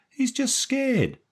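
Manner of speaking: high, very slow soothing moll voice; smiling moll. A narrator's reading. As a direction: reassure him as the moll, smiling. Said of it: "smiling at Stevie".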